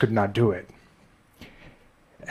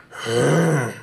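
drawn-out Frankenstein's monster grunt; 3 semitones higher than usual. Acted. Animator voicing Frankenstein's monster with a long grunt. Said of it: "had to grunt a lot".

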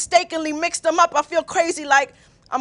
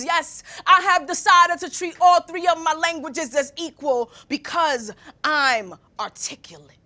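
ordinary talk, nothing marked

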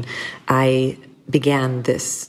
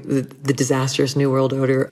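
ordinary talk, nothing marked

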